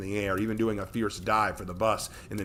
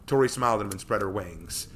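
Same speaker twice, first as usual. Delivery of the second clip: ordinary delivery, nothing marked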